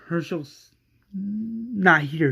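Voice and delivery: ordinary delivery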